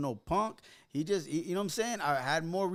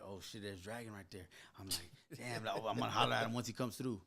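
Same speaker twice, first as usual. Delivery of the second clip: very low and quiet, as if to oneself; retold inner thought; 10 semitones lower, 10 dB quieter than usual. Teller recalling spotting Dragon in the crowd, retold inner thought. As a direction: murmur the thought under your breath.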